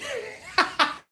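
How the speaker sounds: squeaky burst of laughter; real high giggle. Real, not acted. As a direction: crack up helplessly. Laughing at own impression.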